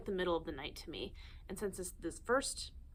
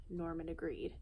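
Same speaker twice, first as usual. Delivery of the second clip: ordinary delivery, nothing marked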